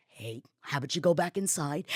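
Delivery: low, soft retold suggestion; calming coworker. Coworker sending the screamer back inside, low and soft. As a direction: quietly talk the ranter down. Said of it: "and she's like".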